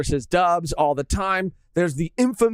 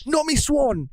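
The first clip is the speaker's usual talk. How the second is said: higher-pitched mock British; British accent; 6 semitones higher, slower than usual. Joking how British Sanji would address Nami, mock British accent. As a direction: imitate an anime catchphrase in put-on British. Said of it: "he's not gonna say".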